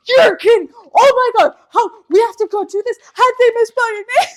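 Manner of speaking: very high, loud imitated outrage; hammed-up incredulous yell; outraged shriek. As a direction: shriek it as a scandalized mother. Acts mom's outraged reaction.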